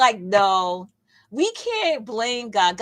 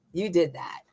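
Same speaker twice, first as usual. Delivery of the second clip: usual speaking voice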